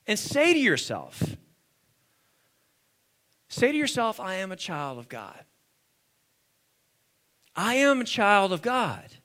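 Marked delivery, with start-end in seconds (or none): 4.2-5.4 low, soft personal declaration; hushed affirmation. Real, not acted. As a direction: say it quietly, as if to yourself. Models line for congregation to repeat.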